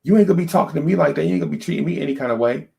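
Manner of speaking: fast, high, indignant rant; wife ranting at her husband, fast and high. Retold or imitated. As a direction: rattle it off like an offended wife. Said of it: "my wife is like".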